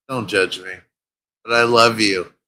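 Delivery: normal talking voice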